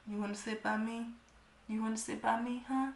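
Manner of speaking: mock a creep luring her closer; a creepy guy whispering an invite; creepy coaxing. Same creep again.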